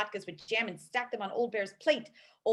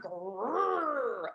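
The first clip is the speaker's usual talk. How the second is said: bear growl. Acted. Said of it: "Old Bear roared".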